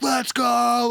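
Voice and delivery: high, hyped imitation of a singer's call. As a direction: mimic a frontman calling someone out. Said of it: "like, calling me out".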